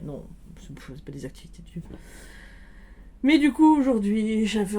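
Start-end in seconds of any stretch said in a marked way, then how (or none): 0.0-1.8 breathy whisper to herself; half-whispered correction; whispered aside. Real, not acted.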